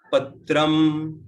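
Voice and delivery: Patram said slowly and loudly, one word alone; staccato. Shown as a demonstration. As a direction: give Patram as a single slow separate word. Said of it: "read out one word at a time".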